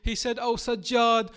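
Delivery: impassioned lament preaching. Acted. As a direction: wail the story of the martyrs to the mourners. Lament preaching building to climax.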